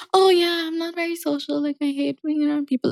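mock antisocial character. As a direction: lightly imitate her in a small, high voice. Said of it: "she's like".

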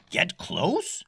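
high, startled protest; alarmed yell. A narrator's reading. Captain objecting to steering closer, alarmed. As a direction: balk at the order. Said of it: "yelled the captain".

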